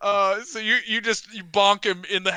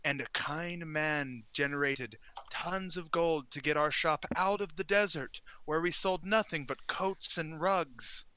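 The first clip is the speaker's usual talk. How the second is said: storytelling merchant. Acted. Game master voices coat and rug merchant.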